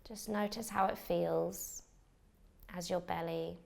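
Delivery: calm, unhurried meditation guide with long pauses; measured meditation voice, leaving space for each breath; guided meditation. Acted. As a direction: lead a beginner gently into relaxation, phrase by phrase. Leads a beginner's meditation.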